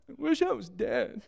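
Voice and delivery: small, high, softened whimper; mock despair. Elijah answering miracle after miracle, mock despair. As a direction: feeble self-pitying whine, played for laughs. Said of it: "And how does he respond?".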